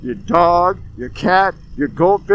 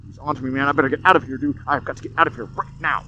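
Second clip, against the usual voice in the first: mock paranoid panic; 5 semitones lower, 10 dB quieter, faster than usual. Mocking paranoia at motorcyclist.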